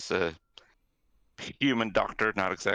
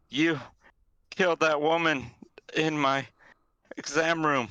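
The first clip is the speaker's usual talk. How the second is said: halting, slightly higher, word by word; shaky writing; 6 semitones higher than usual. Acted. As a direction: voice it as written out in broken pieces. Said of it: "She shakily s-, writes down".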